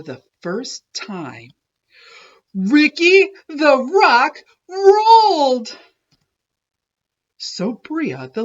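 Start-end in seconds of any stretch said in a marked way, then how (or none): 2.5-5.8 slow, high, loud joyful cry; triumphant. A narrator's reading. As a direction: cheer the big moment. Triumphant story climax.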